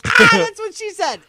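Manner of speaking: very high, loud mock yell; shrieked punchline, way up high; retold gleeful outburst. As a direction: blurt the joke out in a shriek. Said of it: "And I was like".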